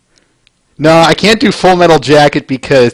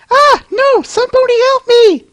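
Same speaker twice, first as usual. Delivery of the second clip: high female scream. Acted. Terrible female voice, pony screaming at the swamp monster. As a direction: shriek for help in a high voice.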